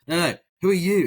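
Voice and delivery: mock impatient demand; insistent repeat. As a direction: push the question harder. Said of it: "And he's like".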